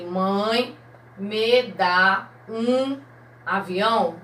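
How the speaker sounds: reading it slowly, word by word; slow, split reading. Shown as a demonstration. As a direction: spell the sentence out slowly for children. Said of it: "Olha aqui". (leitura lenta, palavra por palavra)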